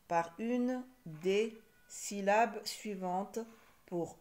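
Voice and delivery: word groups separated by pauses; measured dictation-like reading to pupils; slow instruction reading. Shown as a demonstration. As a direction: read it out slowly, chunk by chunk. Reads exercise instruction aloud slowly.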